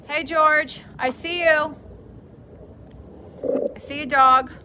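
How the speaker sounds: loud, teasing call to a dog; playful call. Real, not acted. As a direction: call out playfully across the room. Calling to the hiding dog.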